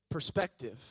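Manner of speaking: usual speaking voice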